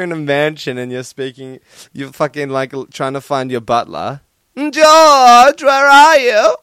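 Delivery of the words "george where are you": high, loud posh lord calling out. Acted. Lord in a mansion calling for his butler, high and loud. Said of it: "I don't know what that accent was".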